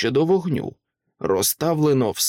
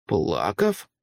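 low, shocked question rising at the end; startled echo; 6 semitones lower than usual. A narrator's reading. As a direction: repeat the word in disbelief. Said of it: "перепитав вражений правник". (здивовано перепитує)

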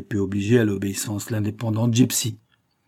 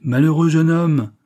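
loud, high reproach; impassioned exclamation; 7 semitones higher, 7 dB louder than usual. A narrator's reading. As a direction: cry out in exasperated pity. Verduret rebukes the despairing young man. (exclamation véhémente)

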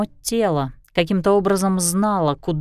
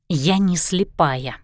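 ordinary delivery, nothing marked

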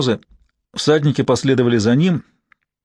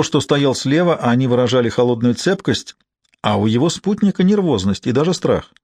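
ordinary delivery, nothing marked

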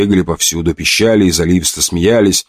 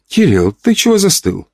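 put-on a lightly raised child's question; little girl voice; 10 semitones higher than usual. A narrator's reading. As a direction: ask like a puzzled little girl. Child asks frozen uncle.

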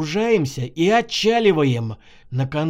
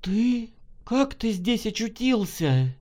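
higher, hushed disbelief; astonished woman's voice; stunned; 6 semitones higher, 3 dB quieter, slower than usual. A narrator's reading. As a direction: ask it pitched up, barely believing. Woman stunned by unexpected meeting.

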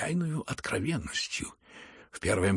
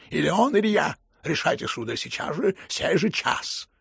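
frenzied shout; 6 semitones higher, 5 dB louder than usual. A narrator's reading. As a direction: cry out an ultimatum in a frenzy. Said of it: "крикнул он опять в выступлении".

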